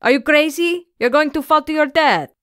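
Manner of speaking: high, loud and fast panicked yell; alarmed yell. Acted. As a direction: shout up in alarm at the climber. Seaman yells up the mast.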